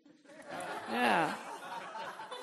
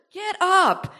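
higher, pressing call; rousing command; 5 semitones higher than usual. Real, not acted. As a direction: push them up. Preacher urging congregation.

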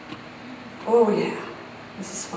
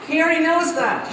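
normal talking voice

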